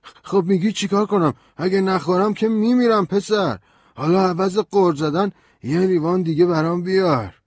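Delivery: a tipsy lawyer protesting; high, loud slurring drunk voice; surprised drunk. A narrator's reading. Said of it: "مرد با تعجب گفت".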